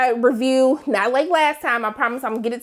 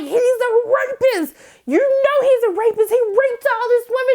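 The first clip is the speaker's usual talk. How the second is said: mock outrage; 10 semitones higher than usual. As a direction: imitate June calling him out, pitched up. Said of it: "she pushed Mark and she was like".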